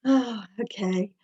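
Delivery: ordinary talk, nothing marked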